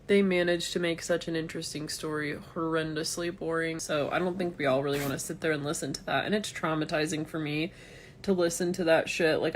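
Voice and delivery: ordinary talk, nothing marked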